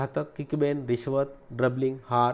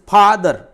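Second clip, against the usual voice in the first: "Father" loud, split as फा and दर; syllable by syllable; 4 semitones higher, 12 dB louder, much slower than usual. Shown as a demonstration. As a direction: punch "father" in two beats, फा drawn out. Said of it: "फा अलग होगा".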